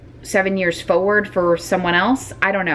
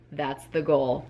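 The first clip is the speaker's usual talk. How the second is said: softer, lower under-breath repeat; quiet; 4 semitones lower, 7 dB quieter than usual. Real, not acted. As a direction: mutter it to yourself in doubt.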